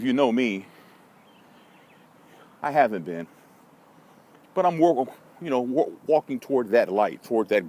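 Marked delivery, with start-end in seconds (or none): none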